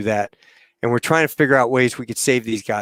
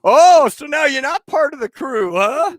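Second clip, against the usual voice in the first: high, loud teasing challenge; mock indignation; 14 semitones higher, 4 dB louder than usual. Crew ribbing Tony after his threat, mock indignant. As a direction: needle him in a raised voice.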